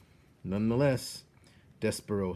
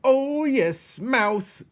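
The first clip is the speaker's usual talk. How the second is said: high, loud gleeful rat agreeing; eager rat; 11 dB louder, much slower than usual. Acted. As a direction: chime in hungrily. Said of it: "agreed another".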